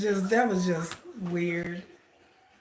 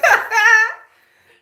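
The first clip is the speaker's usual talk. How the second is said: squealing laugh, higher and louder; high laughing fit; 13 dB louder than usual. Real, not acted. Watching the speaker drop the mic, a high squealing laugh. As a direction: laugh until it almost turns to crying.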